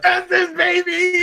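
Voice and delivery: high, loud triumphant shout; excited cheer. Real, not acted. Player cheering her perfect dice roll, excited shout. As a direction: yell it out like a winning roll. Rolled four successes, celebrating.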